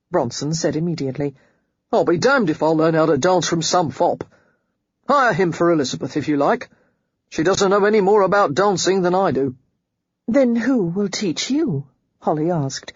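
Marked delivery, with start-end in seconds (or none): 1.9-9.6 louder, gruff refusal from a self-made man; Bronson's voice. A narrator's reading. Bronson refuses a dancing master, loud and gruff. 10.3-11.9 slow and patient, a touch higher. A narrator's reading. Holly asks Bronson patiently and slowly. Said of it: "making her voice very patient".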